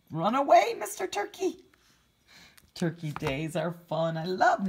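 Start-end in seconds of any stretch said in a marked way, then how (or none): 0.1-1.6 playful cheer. Acted. Cheering the turkey in picture.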